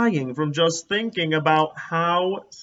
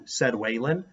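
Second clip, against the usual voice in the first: usual speaking voice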